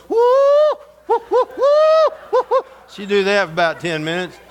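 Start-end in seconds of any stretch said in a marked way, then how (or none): 0.1-2.6 chicken woman whoop. Retold or imitated. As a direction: hoot way up high like a church lady in the spirit. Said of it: "chicken woman jump up and go".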